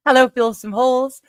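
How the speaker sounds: ordinary delivery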